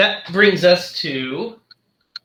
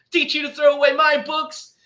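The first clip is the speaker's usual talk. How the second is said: high-pitched imitation of a gloating boy; hammed-up little avenger, pitched way up; mock vengeful kid; 12 semitones higher than usual. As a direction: put on a kid's spiteful threat. Retold boy's revenge while stabbing doll.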